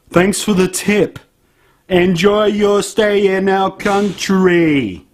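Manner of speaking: slow, loud mock-polite sarcasm; sarcastic thanks. Real, not acted. Sarcastically thanking the condescending tourists, slow and loud. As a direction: drip sarcasm, drawn out.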